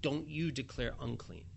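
a quiet grave decree; solemn declaration. Acted. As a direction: lay it down slowly and softly. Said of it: "the Lord makes this declaration".

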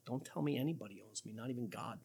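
soft, quick, lightly voiced proud refusal; hint of a defiant man, hushed and fast; arrogant unbeliever. Preacher voicing mankind's arrogance against God, soft and quick. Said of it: "This is the arrogance of mankind".

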